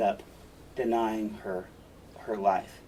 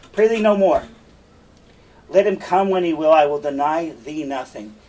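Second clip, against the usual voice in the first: frustrated; 2 semitones higher, 8 dB louder than usual. Acted. Othello giving in to Desdemona's persistent pleading, frustrated. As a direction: give in loudly, fed up with the pleading. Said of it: "He's frustrated".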